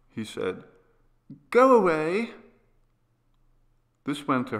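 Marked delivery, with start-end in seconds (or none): 1.5-2.3 put-on high, loud, insistent child; urgent high-pitched dismissal; agitated sick boy. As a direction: order them out in a raised boy's voice. Sick boy sending everyone away.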